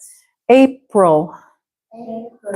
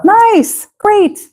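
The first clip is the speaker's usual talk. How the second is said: bright, much higher praise burst; delighted praise; 12 semitones higher, 3 dB louder than usual. Real, not acted. Tutor cheers the student's correct answer, delighted.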